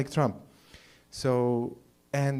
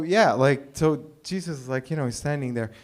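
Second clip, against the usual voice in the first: ordinary talk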